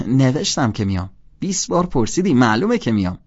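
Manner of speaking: loud, annoyed snap; irritated retort. Acted. Holden snapping at Sally for asking again, loud and annoyed. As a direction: bite back at her pestering.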